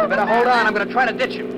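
loud, high, tense shout; urgent warning. Acted. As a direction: warn her loudly as danger closes in.

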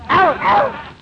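yelps of pain. Acted. Suddenly hurt.

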